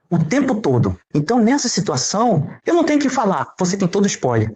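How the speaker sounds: ordinary talk, nothing marked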